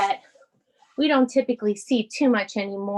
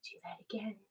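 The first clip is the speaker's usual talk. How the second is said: whisper; 3 semitones lower, 18 dB quieter, faster than usual. Real, not acted. Quiet cue between hush breaths.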